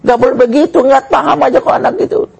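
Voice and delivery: high-pitched mock maternal scolding; scolding mother. Mother rushes down to scold father, high indignant voice. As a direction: tell off the husband in a high voice. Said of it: "Ibunya turun langsung bilang".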